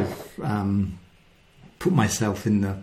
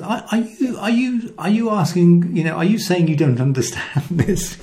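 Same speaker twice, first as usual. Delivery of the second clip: much higher, amused voice asking his friends; teasing Jesus; 7 semitones higher than usual. Retold or imitated. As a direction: play Jesus gently ribbing confused disciples.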